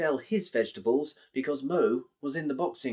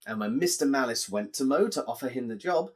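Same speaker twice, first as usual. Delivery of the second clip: plain reading voice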